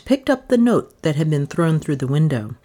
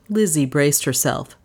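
ordinary delivery, nothing marked

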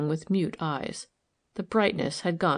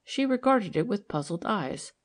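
ordinary delivery, nothing marked

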